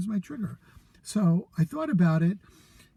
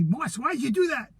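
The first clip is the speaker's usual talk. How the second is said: higher, reproachful mother's voice; mother's critical voice; 7 semitones higher than usual. Retold or imitated. Son imitating his critical mother scolding him, higher reproach. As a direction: scold him like a disapproving mom. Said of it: "my mother's critical voice".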